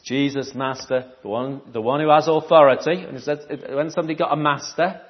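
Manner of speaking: ordinary talk, nothing marked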